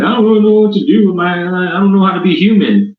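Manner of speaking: high, fast, panicky pleading; high whiny Q voice. Retold or imitated. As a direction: squeak it out like lost Q. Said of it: "And he's like".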